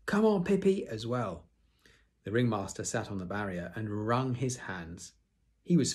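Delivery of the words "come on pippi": high, loud cheering yell; children cheering. Acted. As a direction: cheer your friend on. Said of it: "they shouted".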